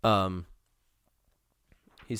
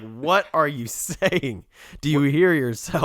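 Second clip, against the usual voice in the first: slow, higher incredulous voice; exasperated Socrates mocked; mock disbelief; 5 semitones higher, much slower than usual. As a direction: ask it like you can't believe him. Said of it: "It's just like".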